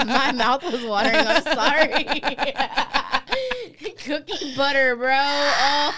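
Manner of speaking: high, loud, laughing through the words; can't stop giggling about the food; laughing giddily. Real, not acted. Friend gushing to her cohost about cookie butter, laughing.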